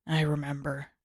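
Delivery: low, grudging mumble; grumbling Zuko. Acted. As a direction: grumble it like you've heard enough. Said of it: "Zuko grumbled".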